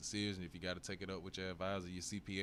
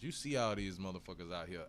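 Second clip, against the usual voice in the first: ordinary talk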